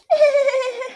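high giggle. Real, not acted. Giggle after disgust.

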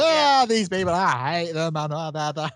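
loud, very high parody of a friend's outburst; mock ranting. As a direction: imitate a friend wailing incoherently, trailing into babble. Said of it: "because it was just like".

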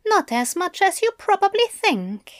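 high, light teasing angel. A narrator's reading. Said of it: "Aziraphale said".

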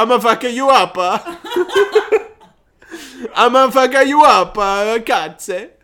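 high, loud hammed-up Italian accent threat; mock Italian tough guy. Friend jokes how Italians do karate, mock Italian accent. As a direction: play a cartoon Italian karate thug, laughing through it.

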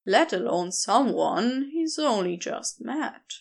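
plain reading voice

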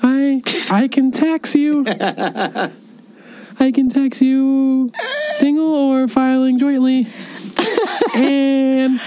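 high, slow put-on grown-up voice; fake accountant dad voice. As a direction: play the stuffy accountant father. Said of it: "This is a great impression".

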